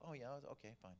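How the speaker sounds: ordinary delivery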